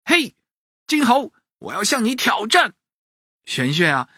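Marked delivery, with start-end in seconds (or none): 0.1-2.7 put-on high boy's challenge, mild call. A narrator's reading. Boy calls up to the monkey, challenging. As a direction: hail the rival in a high child's voice. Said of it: "冲着金猴喊着".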